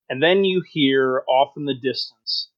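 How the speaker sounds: usual speaking voice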